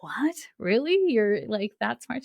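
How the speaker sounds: high astonished disbelief; lightly acted surprise; amazed. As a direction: gasp it out, stunned. Stunned reaction, high.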